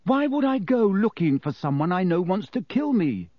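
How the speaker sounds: high-pitched, baffled question; blank puzzlement. A narrator's reading. Boy baffled by man's request, blankly puzzled. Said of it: "said Harry blankly".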